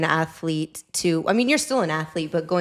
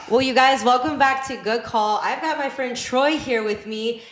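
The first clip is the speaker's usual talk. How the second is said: host intro; 5 semitones higher than usual. Acted.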